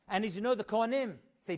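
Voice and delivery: normal talking voice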